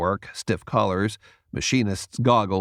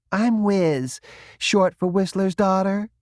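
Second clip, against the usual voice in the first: put-on perky girl; 10 semitones higher than usual. A narrator's reading. Wiz introduces herself.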